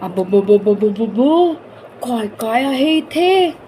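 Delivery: put-on slow, drawn-out wonder of a small boy; child's amazement. A narrator's reading. Boy gasps in wonder at the dusty loft's contents. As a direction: marvel at the treasures slowly.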